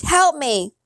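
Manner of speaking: high-pitched plea; scream for help. A narrator's reading. Boy screaming to the witch for help. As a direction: call out for help, pitched well up. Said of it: "I screamed".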